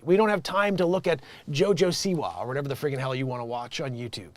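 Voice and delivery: normal talking voice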